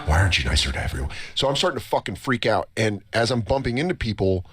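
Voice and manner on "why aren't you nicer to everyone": low, lightly voiced inner scolding; a hint of a stern inner voice; mock scolding conscience. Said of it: "in my mushroom head, I'm like".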